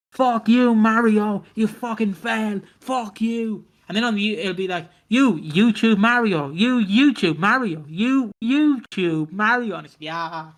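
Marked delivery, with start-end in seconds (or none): 0.1-3.6 loud, high-pitched imitated swearing rant; mock gamer rage. Streamer cursing at Mario on his live stream, mock rage. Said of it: "on the live stream, he'd go". 5.1-9.7 slow, high, sing-song imitated swearing with words swapped in; censored mock rant. Swearing swapped out for the main channel upload, censored mock rant. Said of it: "replace me swearing with".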